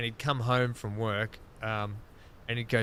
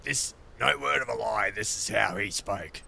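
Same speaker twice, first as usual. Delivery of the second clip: imitating his mate Fats; 3 semitones lower than usual. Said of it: "he spoke like this".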